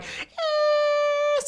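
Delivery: high squealing brake noise, very high; imitated brake squeal. Telling friends about the crash, squealing brake noise. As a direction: mimic a squeaky disc rotor. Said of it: "my disc rotors are like".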